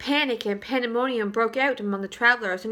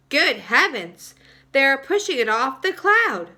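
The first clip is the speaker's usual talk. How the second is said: louder, alarmed exclamation; crying out; 3 semitones higher, 7 dB louder than usual. Acted. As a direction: James crying out as the rainbow is pushed off. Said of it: "cried James".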